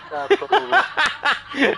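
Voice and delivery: high, loud, slow through laughter; laughing. Real, not acted. Caller agreeing to a crude tease, laughing hard. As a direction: laugh the words out helplessly.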